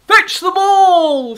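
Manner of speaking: slow, booming wolf shout. Acted. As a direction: bellow it like a greedy wolf. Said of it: "shouted the wolf".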